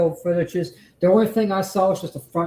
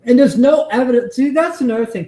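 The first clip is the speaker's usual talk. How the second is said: heated outburst; 8 semitones higher, 7 dB louder, faster than usual. Real, not acted. Streamer angrily ranting at clip.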